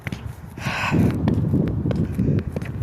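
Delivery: long, breathy, weary real sigh; heavy sigh. Real, not acted. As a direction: let out a long tired breath.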